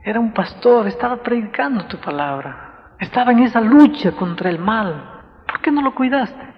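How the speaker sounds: rising, pleading complaint to God; anguished plea. Retold or imitated. Reclamo angustiado a Dios. (reclamo angustiado a Dios, cada vez más agudo)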